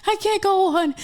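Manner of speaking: high, hammed-up tearful confession; retold crying. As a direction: wail it like a re-enacted breakdown. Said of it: "I'm crying 'cause I'm like".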